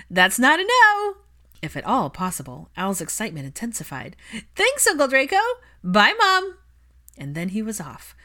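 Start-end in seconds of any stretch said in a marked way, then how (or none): 0.1-1.2 put-on high, loud, eager little boy pushing his luck; a cheeky kid who won't take no; young boy's voice. Al pressing his reluctant dad, high eager child voice. 4.6-6.6 put-on high, loud little-boy goodbye; a kid already halfway out the door; excited young boy. Al thanking his uncle and dashing off, high excited child voice.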